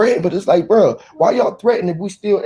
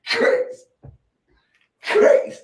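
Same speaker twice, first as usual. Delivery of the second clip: whispered crazy; slower than usual. Real, not acted.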